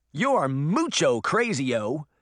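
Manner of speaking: put-on slow little-girl character voice; crying out. A narrator's reading. Daphne holding Elvis back from the witch, crying out. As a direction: cry it out as an indignant child. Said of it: "Daphne cried".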